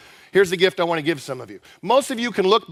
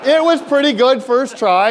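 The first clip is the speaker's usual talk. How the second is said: laughing; 6 semitones higher, 7 dB louder than usual. Real, not acted. Laughing praise after cheer.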